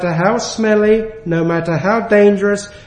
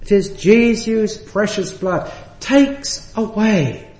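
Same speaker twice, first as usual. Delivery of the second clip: each hymn line spoken slow and measured; slow hymn recital; slower than usual. Retold or imitated. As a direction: recite the old hymn slowly, savouring it. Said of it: "and it goes like this".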